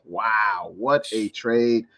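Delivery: usual speaking voice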